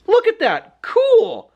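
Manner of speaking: put-on a wide-eyed little girl; little sister gasping at the ink, high and delighted; amazed little sister. Little sister sees ink blood.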